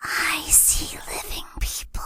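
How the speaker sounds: a ghost hissing a secret; quick eerie whisper; creepy ghost whisper. Acted. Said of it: "Or do I sound kind of creepy".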